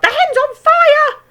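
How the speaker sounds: shrieked very high and loud; yelling grandma. A narrator's reading. Grandma yelling as the hen smokes. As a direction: scream the alarm. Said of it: "Grandma yelled".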